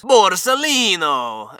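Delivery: loud, drawn-out mock Italian accent; big theatrical Italian accent. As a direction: belt it out in a hammy Italian accent, high and slow. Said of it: "say it with that accent".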